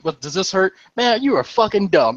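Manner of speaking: high-pitched, mock-angry dressing-down; retold berating. Medic treating the crashed driver, mock scolding while examining him. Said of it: "I'm just going in".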